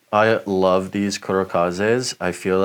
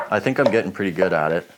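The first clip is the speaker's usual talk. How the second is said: normal talking voice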